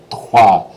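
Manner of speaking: "toi" with a throaty French R after the t; "toi" for three, R rasped; rolled R trois. Shown as a demonstration. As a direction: roll the R in "toi", loudly. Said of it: "you gotta roll that R sound".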